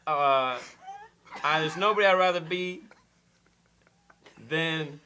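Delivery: a light, halting Walken impression; mild Walken cadence, choppy and deliberate; Christopher Walken. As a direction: pause oddly between phrases like Walken. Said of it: "Christopher Walken".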